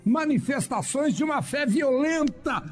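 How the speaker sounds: high, sustained revival preaching; pregação inflamada. Acted. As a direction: preach it fervently, pitch held high. Preaches at full force. (pregação fervorosa em tom alto)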